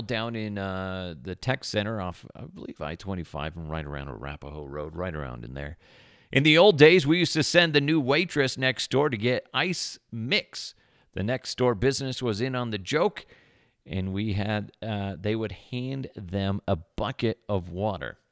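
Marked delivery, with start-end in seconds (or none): 6.3-13.2 reading submission aloud. Acted.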